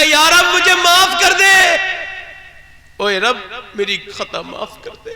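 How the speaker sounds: desperate prayer for forgiveness; anguished plea. Acted. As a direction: cry out to God for mercy. Saint cries out for forgiveness.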